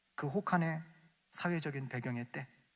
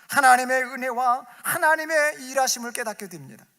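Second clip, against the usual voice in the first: proclaiming preaching; 9 semitones higher, 10 dB louder than usual. Acted. Preaching climax.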